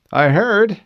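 teasing. A narrator's reading. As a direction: tease her playfully. Teasing squadmate.

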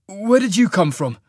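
loud, high demand. Acted. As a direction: call it out hard at the stranger. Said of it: "he demanded".

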